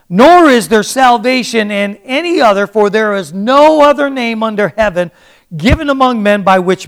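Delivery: loud, high-pitched scripture proclamation; full-force preaching. Acted. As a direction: proclaim the verse boldly to the whole room.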